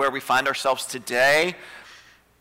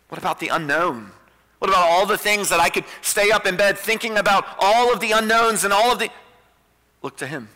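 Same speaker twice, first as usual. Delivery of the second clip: ordinary talk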